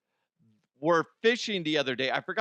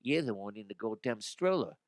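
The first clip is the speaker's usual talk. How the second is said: voicing the other dog; 4 semitones lower, 6 dB quieter than usual. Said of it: "be like".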